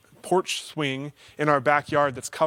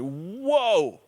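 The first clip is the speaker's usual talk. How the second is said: sudden higher whoa of self-surprise; startled whoa; 4 semitones higher than usual. Retold or imitated. Catching himself sounding sharp, startled whoa. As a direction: catch yourself off guard. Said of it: "And I'm like".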